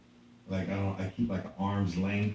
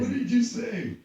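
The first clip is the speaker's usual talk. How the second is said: high-pitched, incredulous comeback; mock indignant challenge; 14 semitones higher, 4 dB louder than usual. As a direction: play at being offended and challenge it. Acted challenge to rude agent.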